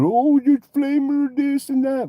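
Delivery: high, slow mocking sing-song; mock what people say in a silly high voice; mocking quote. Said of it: "people are".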